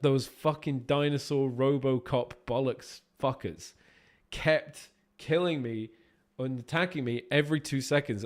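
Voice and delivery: ordinary talk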